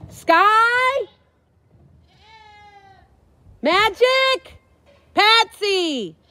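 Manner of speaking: high, loud calling out names; calling horses. Real, not acted. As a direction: call the horses from the shed.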